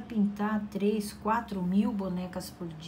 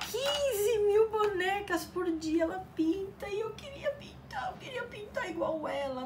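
high, squeaky fake sobbing; hammed-up envious whimper; mock crying; 12 semitones higher than usual. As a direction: whine it out like a jealous crybaby, voice breaking into a whisper.

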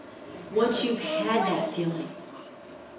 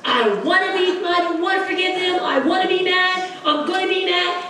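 stubborn sulking; 7 semitones higher than usual. Acted. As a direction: pout like a stubborn child. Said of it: "You gotta look at that person".

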